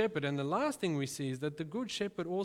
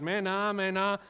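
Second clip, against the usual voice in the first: loud, higher call, a little unsure; American trying the call; 4 semitones higher, 6 dB louder than usual. Retold or imitated. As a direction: mimic the shepherd's call as an outsider. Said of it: "he starts yelling".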